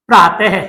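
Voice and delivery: "प्रातः" ending in an audible "heh"; visarga pronunciation. Shown as a demonstration. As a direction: sound the final h of "प्रातः" as its own syllable. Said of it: "जब प्रातः का तो उच्चारण करेंगे तो ध्वनि किसकी आ रही है". ("प्रातः" के अंत में "ह" की ध्वनि)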